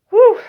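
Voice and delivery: high, loud relieved exhale; loud whew. Real, not acted. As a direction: blow out hard in relief. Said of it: "I'm out of breath".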